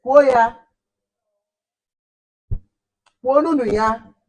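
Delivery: calls out loudly to the class. Real, not acted. Teacher calling loudly for pupils to repeat. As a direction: shout it out to get them to repeat. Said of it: "loud call to class".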